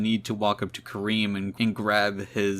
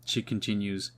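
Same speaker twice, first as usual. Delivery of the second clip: ordinary delivery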